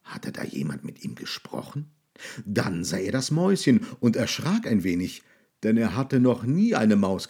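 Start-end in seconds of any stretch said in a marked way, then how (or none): 0.0-1.9 soft whisper; whispered wondering. A narrator's reading. Monster wonders to himself who spoke, whispered. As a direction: breathe it out, puzzled.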